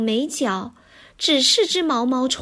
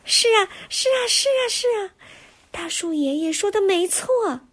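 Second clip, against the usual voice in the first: high tiny voices of small plants; tiny little voices; 7 semitones higher, faster than usual. Acted. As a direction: squeak it out like little sprouts. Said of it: "好多细小的声音从周围冒了出来". (细小的声音)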